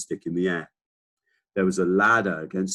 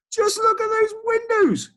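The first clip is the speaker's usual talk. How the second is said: much higher, louder excited monkey; monkey crying out; 6 dB louder than usual. Acted. Monkey spotting the Duke's windows, much higher excited cry. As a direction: squeal it like a thrilled monkey. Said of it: "cried the monkey".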